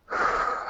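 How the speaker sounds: long soft sigh out; calming exhale. Shown as a demonstration. Husband calming himself before trying again, soft sigh. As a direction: breathe it out to calm down. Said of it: "five big deep breaths".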